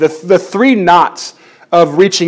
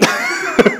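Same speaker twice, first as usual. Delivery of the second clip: high delighted laugh; 7 dB quieter than usual. Retold or imitated. Relief at nurse taking baby.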